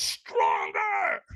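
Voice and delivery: harsh, full-throated retold scream; screamed command. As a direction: scream it like a fierce trainer. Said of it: "So he screams at me".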